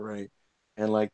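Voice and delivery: ordinary talk, nothing marked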